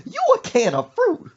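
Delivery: high, loud incredulous screech; mock outraged mama. Mother throwing back son's offer, screechy mock outrage. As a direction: shriek it back as an outraged question.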